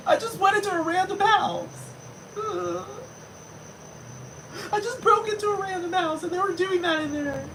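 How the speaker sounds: high falsetto whimper with laughs; mock sobbing. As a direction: keep the fake crying falsetto going. Continues the mock crying voice.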